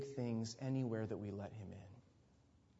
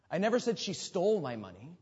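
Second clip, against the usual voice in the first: "stole" punched out; emphasize "stole" above all other words; stress on STOLE; 4 semitones higher than usual. Shown as a demonstration. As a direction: hit the word "stole" hardest. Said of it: "emphasize a different word".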